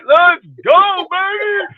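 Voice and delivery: very high, loud fan cheer; hyped cheer. Retold or imitated. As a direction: scream it from the stands. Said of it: "I was like".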